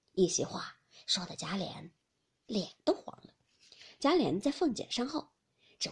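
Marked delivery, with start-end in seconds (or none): none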